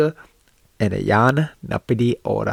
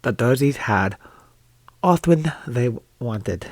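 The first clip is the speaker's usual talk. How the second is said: attempted Scottish accent. Shown as a demonstration. Reading the Scots edition to viewers in an amateur Scottish accent. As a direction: stumble through the Scots in a put-on Scottish accent. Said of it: "a Scottish accent".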